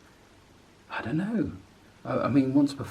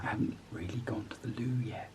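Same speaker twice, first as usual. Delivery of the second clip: whispering; 10 dB quieter than usual. Real, not acted. Confiding whisper, others asleep.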